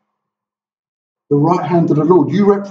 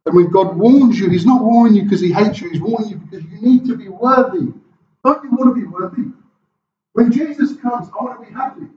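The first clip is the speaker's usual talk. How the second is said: higher, rising exhortation; passionate preaching; 6 semitones higher, 3 dB louder than usual. Acted. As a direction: plead with them to be worthy. Preaching at full force.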